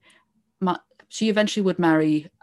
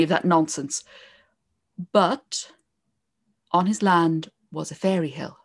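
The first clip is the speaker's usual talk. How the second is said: epic fantasy narrator; 2 semitones higher than usual. Acted. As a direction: build the legend like a fireside tale. Starts telling the O'Neill legend.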